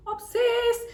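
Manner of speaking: high, loud obsessed; excited squeal. Real, not acted. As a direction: squeal the word out.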